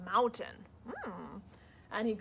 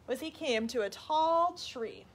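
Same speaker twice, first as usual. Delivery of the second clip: ordinary delivery, nothing marked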